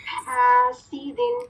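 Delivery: हासिदिन slow, seen with a clear kasra; slow Quranic recitation. Shown as a demonstration. Teacher repeats हासिदिन slowly for students. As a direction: model हासिदिन slowly and deliberately. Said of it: "सीन की कसरा mark करें".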